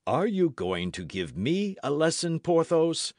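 mild flash of temper; bristle, voice lifting slightly; affronted cry. A narrator's reading. Aramis resents a lesson from Porthos, affronted cry. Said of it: "cried Aramis".

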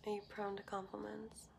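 whispering. Real, not acted. Reads viewer question hushed.

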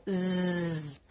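"Z" as a long buzz, zzz; sustained voiced zzz; letter sound. Shown as a demonstration. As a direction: hold the buzzing sound of "Z", not its name. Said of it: "ele vai fazer som Z".

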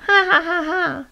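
high mocking ha-ha laugh; hyena's laugh. A narrator's reading. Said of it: "said the hyena".

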